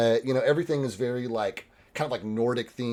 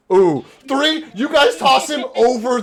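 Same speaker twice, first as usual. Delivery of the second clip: excited; 12 semitones higher, 10 dB louder than usual. Real, not acted. Reacting to low roll.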